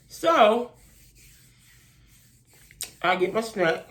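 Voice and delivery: talking around a bite; chewing while talking; mouth full. Real, not acted. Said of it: "let me put this in my mouth".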